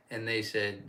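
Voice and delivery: ordinary talk, nothing marked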